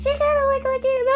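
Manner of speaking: high, sneering parrot of the coworker; mocking imitation. Retelling a coworker's creepy remark, high mocking voice. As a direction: echo her words back high with contempt.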